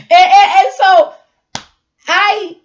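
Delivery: loud burst through laughter; laughing. Real, not acted. Vlogger cracking up at her own story, laughing loudly. Breaks up while telling story.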